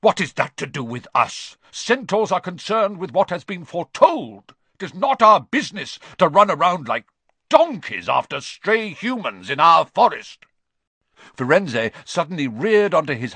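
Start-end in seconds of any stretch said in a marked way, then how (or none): 0.0-10.4 irate centaur, high and loud; raised-voice indignant tirade; angry outburst. A narrator's reading. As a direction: throw it back in outrage. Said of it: "kicked his back legs in anger".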